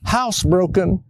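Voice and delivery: put-on high little girl's uh-oh; young daughter. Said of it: "I heard Sarah from her room".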